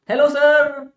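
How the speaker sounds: high, loud chirpy sales greeting; cheerful telecaller. Retold or imitated. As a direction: sound overly happy to a customer. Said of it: "बड़ी खुश आवाज में".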